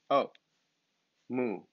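ordinary delivery

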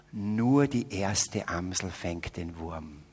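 slow, low, savoring each word with a laugh; solemn proverb. Shown as a demonstration. Preacher solemnly presents a Salzburg saying as a joke. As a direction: deliver it like a sacred saying. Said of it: "Dieses Wort heißt".

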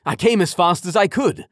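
loud, high hearty dad; hearty father voice. A narrator's reading. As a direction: apologise heartily. Father apologises to couple.

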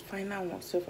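ordinary talk, nothing marked

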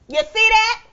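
high, loud disbelief; loud shocked appeal; outraged shock. Acted. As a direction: call the neighbors to witness.

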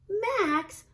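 drawn-out exasperated big-sister call; big sister Ruby. A narrator's reading. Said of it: "said Ruby".

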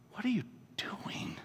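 urgent whisper. Retold or imitated. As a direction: whisper it, embarrassed for him. Wife hissing at him in store.

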